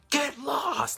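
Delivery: high-pitched mock shout; retold guard's yell, pitched high. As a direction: bark it at the man behind. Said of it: "Like, shouted".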